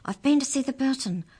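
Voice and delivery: Amy gabbles it out; excited. Acted.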